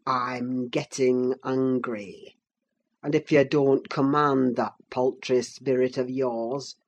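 low, menacing, held-in anger; restrained threat; curbed ferocity. A narrator's reading. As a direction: keep the rage reined in, low and deliberate. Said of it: "with curbed ferocity".

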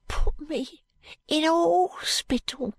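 slow, high, hushed urchin. A narrator's reading. Jo secretly answering Allan, slow high hushed voice. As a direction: confide it in a small high voice. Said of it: "replied Jo, whispering".

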